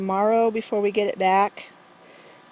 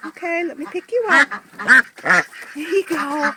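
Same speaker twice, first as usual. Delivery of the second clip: high pet talk; 6 semitones higher, 9 dB louder than usual. Real, not acted. Talking to duck, picking up.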